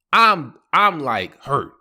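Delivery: normal talking voice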